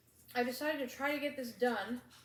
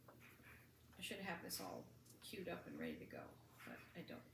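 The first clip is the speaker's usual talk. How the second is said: muttering; 3 semitones lower, 13 dB quieter than usual. Real, not acted. Mutters while searching.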